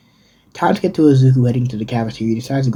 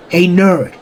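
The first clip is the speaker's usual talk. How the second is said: higher-pitched hostile hail; confrontational call; 6 semitones higher, 4 dB louder than usual. Acted. Bakugo approaches Izuku at the table, confrontational call. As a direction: call him out like a bully.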